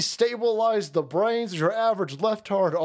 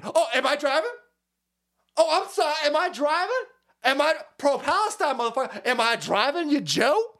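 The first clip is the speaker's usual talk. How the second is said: higher, imitated indignant questions; mock indignation; 6 semitones higher than usual. Mocking the woman in the clip, indignant. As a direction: parody someone indignantly challenging onlookers. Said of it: "getting all indignant with people".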